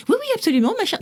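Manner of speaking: hammed-up overeager agreement; mock eager reply. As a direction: intern playing along with the tutors, in a high mock-eager voice. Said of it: "Je me disais".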